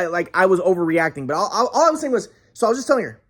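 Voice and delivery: usual speaking voice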